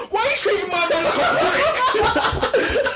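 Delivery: very high, fast mock screech; imitating a high-pitched hysterical outburst; sudden switch to yelling. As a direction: explode into a squealed rant, half laughing. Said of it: "He just switches".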